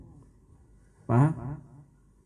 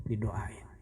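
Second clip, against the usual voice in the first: soft, low unvoiced whisper; 6 semitones lower, 10 dB quieter than usual. Worshipper murmuring in prayer, low whisper. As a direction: breathe it out quietly.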